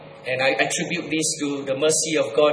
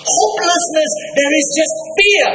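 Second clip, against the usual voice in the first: higher, ringing declaration; preaching emphasis; 12 semitones higher than usual. Acted. Preacher declaring hopelessness to the congregation, high and ringing. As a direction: proclaim it to the hall.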